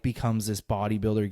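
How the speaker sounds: ordinary talk, nothing marked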